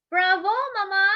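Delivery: high, loud bravo; cheering cry. A narrator's reading. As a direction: cheer for her mother. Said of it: "cried Tia Rosa".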